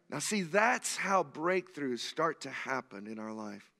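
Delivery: ordinary talk, nothing marked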